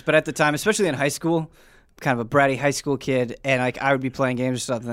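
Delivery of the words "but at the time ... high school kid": normal talking voice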